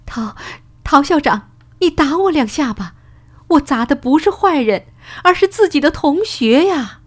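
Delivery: high-pitched, remorseful plea to the headmaster; tearful, remorseful cry. A narrator's reading. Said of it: "他流着眼泪后悔地喊道". (带着哭腔、后悔地喊)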